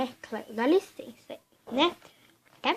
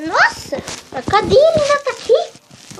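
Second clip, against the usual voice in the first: surprised; 11 semitones higher, 6 dB louder than usual. Real, not acted. Surprised to find the chair.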